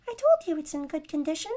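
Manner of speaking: high, quick gnome scold; sour chiding gnome. A narrator's reading. Gnome carriage seller chiding his customer, sour and high. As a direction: chide in a squeaky, sour little voice. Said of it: "chided a sour voice".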